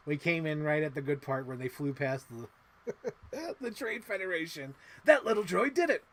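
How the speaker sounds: usual speaking voice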